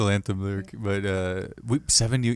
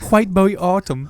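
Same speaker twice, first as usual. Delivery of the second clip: put-on British accent; 9 semitones higher, 4 dB louder than usual. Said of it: "say, like".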